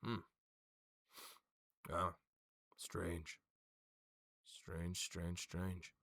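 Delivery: low, soft pondering to oneself; musing. Acted. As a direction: puzzle over it quietly. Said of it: "He goes".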